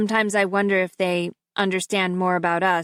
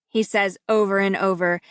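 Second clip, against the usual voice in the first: usual speaking voice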